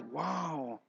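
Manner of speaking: soft, low acted "wow" of awe; hushed realization. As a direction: let it out quietly, as if it just dawned. Acts out a sudden realization.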